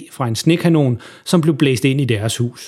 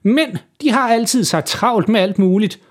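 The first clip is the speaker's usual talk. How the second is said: put-on higher, sour grumbling old neighbour voice; 9 semitones higher than usual. Sour neighbour grumbling to mother in a higher voice. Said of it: "surt som altid".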